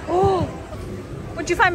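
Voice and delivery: normal talking voice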